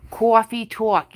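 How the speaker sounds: New York/Jersey "coffee talk" with "cawfee"; lightly hammed Jersey drawl on "coffee"; mock Coffee Talk host; Jersey accent. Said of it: "I got the Jersey going on".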